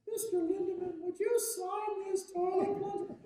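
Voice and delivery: put-on high, slow sing-song kid voice; little boy voice. Nine-year-old boy asks the trumpeter to sign a plunger, in a high sing-song kid voice. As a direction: play an eager nine-year-old asking sweetly. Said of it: "he's like".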